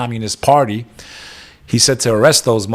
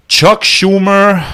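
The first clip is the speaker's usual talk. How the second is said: "Chuck Schumer" called out slow, higher and louder; drawn-out, louder mocking proclamation of "Chuck Schumer"; loud slow announcement; 7 semitones higher, 5 dB louder, much slower than usual. Real, not acted. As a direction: announce the name like a ring introduction. Repeats name, louder.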